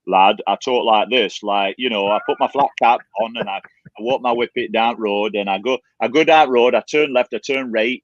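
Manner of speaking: broad Yorkshire demonstration; Yorkshire accent. Shown as a demonstration. Showing the co-host how home talk sounds, Yorkshire accent. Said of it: "that's a Yorkshire accent".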